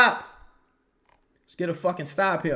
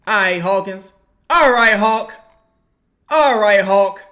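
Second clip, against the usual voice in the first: louder rallying calls to the player; loud encouragement; 4 semitones higher, 10 dB louder than usual. Real, not acted. Fan rallying Hawkins through the screen, loudly. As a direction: pump the player up.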